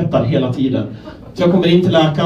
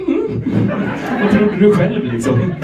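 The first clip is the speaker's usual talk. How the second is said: pained squeak then retort; 5 semitones higher, slower than usual. Retold or imitated. Answering doctor through pain.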